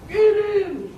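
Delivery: a hint of a mob boss; tiger gangster. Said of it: "And he said".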